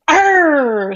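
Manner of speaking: high frustrated growl; angry growl. Acted. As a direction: snarl out your frustration. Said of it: "She was mad".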